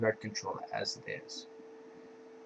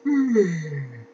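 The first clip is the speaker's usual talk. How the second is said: loud yawn, starting high and falling; real yawn; 7 semitones higher, 7 dB louder than usual. Real, not acted. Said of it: "I don't know why I'm yawning".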